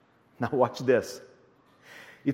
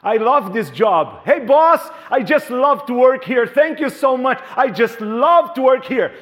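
loud, high mock enthusiasm to the boss; gushing employee; 4 semitones higher, 6 dB louder than usual. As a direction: ham up the eager worker, shouting praise.

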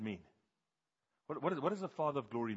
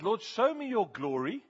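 much higher, louder, slow plea; crying out to God; 10 semitones higher, 8 dB louder, slower than usual. Retold or imitated. Moses begging God to show his glory, slow high cry. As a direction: reach up and beg for his glory. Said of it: "And he says".

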